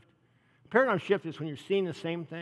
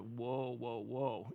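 amazed whoa; 3 semitones lower, 8 dB quieter, much slower than usual. Shown as a demonstration. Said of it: "the way I put it is".

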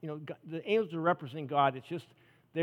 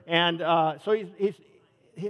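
ordinary talk, nothing marked

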